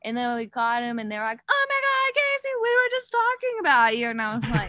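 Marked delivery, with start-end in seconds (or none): 1.5-4.1 high, loud gushing squeal; mimicking excited greeting. Friends greeting Casey as riders catch up, high excited gushing. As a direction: gush like thrilled friends spotting her.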